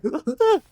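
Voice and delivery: bursting into a high giggle; high giggling laugh. Real, not acted. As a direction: giggle loudly at yourself. Laughing at own anime joke.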